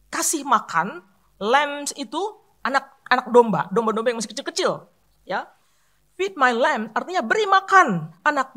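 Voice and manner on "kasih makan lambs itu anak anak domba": ordinary talk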